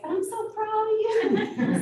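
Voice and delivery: high, slow, proud grandmother voice. Retold or imitated. Grandmother telling her granddaughter she is proud, high and slow. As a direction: voice her grandmother beaming with pride. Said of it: "she was like".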